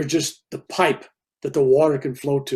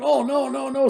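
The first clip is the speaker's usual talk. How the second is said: high wailing "oh no"; people's dismay; 11 semitones higher than usual. Retold or imitated. Onlookers see the broken foot, high anguished groan. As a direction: crowd moaning in dismay, pitched way up. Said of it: "all the people going".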